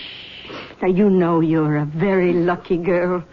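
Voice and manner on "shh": soft breathy shush. Acted. Mother hushing her upset daughter, soft shush. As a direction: hush her gently.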